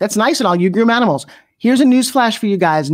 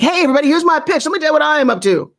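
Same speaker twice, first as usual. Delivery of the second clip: high, fast announcer pitch, fading to a whisper; billboard pitch voice; 7 semitones higher, faster than usual. Retold or imitated. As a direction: rattle it off like a stage pitch to a crowd. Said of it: "So stop sounding like".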